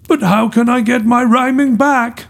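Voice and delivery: high-pitched, pleading despair; despairing cry. A narrator's reading. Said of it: "cried Eco in despair".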